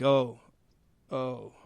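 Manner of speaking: quiet, deflating little "oh"s; retold letdown. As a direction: let the excitement drain away, trailing off softly. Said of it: "And then he saw this".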